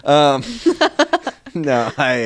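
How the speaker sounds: slow, breaking into laughter; laughing. Real, not acted. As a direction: laugh it out, still catching breath. Laughing after coughing fit.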